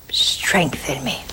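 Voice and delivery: slow hushed strengthen me; a slow whispered plea in prayer. Acted. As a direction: breathe it slowly like a prayer. Praying the line of prayer.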